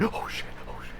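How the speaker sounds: hushed whisper of dismay; under-the-breath panic; whispered alarm. Acted. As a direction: whisper it as a worried bystander. Bystander reacts under breath.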